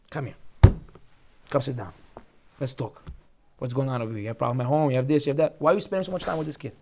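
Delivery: sit him down kindly and ask what's wrong; caring teacher. Pretend. Teacher talking kindly to student.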